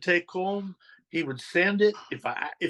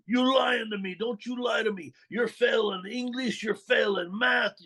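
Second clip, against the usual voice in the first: higher, stern mock scolding; scolding boss; 7 semitones higher than usual. Mob boss scolding teen about failing grades, stern. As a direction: tell the kid off for lying about his grades.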